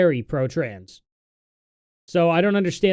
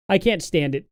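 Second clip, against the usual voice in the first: ordinary talk, nothing marked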